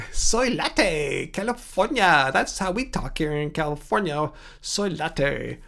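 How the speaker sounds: hammed-up sketch-style California accent; Simpsons bus driver. Host joking how we talk here in California, put-on California accent. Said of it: "I sound like the bus driver on the Simpt-, Simpsons".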